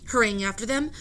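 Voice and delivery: ordinary delivery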